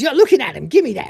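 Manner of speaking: high, loud character impression; Cat. Cat demanding the Subbuteo kit, loud and high mock demand. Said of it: "And the cat says".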